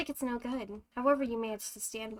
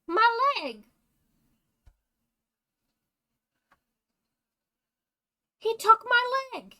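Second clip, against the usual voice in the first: high, very loud agonized scream; 12 semitones higher, 12 dB louder than usual. Acted. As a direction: scream as if your leg was just lost. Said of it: "I'm not screaming again".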